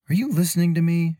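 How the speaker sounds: put-on high, lifted young woman's voice; Mira's voice. A narrator's reading. Traveler telling her companion she's puzzled, in a high worried voice. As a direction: voice Mira up high, puzzled and uneasy. Mira speaks.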